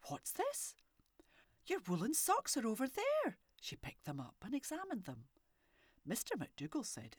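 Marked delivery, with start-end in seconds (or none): 0.1-3.4 puzzled wife. A narrator's reading. Wife finds the socks.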